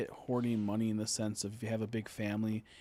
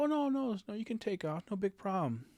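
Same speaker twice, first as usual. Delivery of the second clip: mock boss voice; 10 semitones higher than usual. Mocking the boomer boss.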